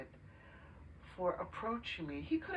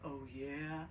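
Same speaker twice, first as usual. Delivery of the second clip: sarcastic oh yeah; 3 semitones lower, 3 dB quieter than usual. Retold or imitated. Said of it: "my first reaction to him was".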